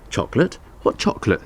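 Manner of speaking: wide-eyed feigned innocence, a rising question; innocent. A narrator's reading. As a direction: play dumb, all innocence. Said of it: "as innocently as he could".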